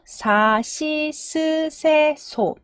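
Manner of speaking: 사, 시, 스, 세, 소 slowly, each held with a clear S; syllable-by-syllable demo. Shown as a demonstration. Teacher showing the S row to learners, syllable-by-syllable demo. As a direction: read out 사, 시, 스, 세, 소 like a kana chart. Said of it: "S가 붙어요".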